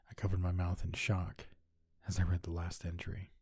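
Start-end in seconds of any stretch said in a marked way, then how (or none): none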